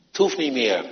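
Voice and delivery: ordinary talk, nothing marked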